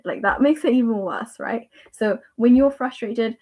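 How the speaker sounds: usual speaking voice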